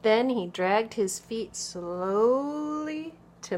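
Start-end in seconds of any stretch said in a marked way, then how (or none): 1.5-3.1 "slowly" stretched very long, pitch gliding up; drawn-out slowly. A narrator's reading. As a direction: drag out "slowly" as long as it goes. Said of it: "dashes between these letters so we say the word slowly, very slowly".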